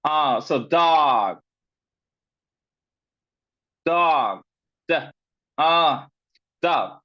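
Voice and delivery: "dog" said slowly with an open ah vowel; "dog" drawn out on a clear ah; slow vowel demo. Shown as a demonstration. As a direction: sound out "dog" as D, ah, dog. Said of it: "It's a ah sound".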